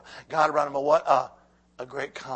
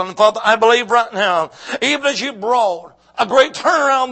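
louder, higher preaching prayer that fades to a hush; fervent prayer; 5 semitones higher, 12 dB louder than usual. Acted. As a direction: pray with rising power over the congregation. Closing prayer, building.

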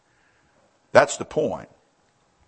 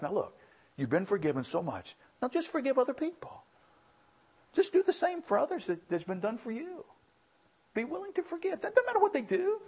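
much higher, soft urging God voice; 12 semitones higher, 6 dB quieter than usual. Acted. God telling the church to forgive, in a high soft voice. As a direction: lift the voice high as God pleading. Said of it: "God says to us".

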